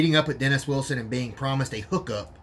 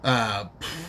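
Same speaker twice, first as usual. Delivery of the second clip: ordinary talk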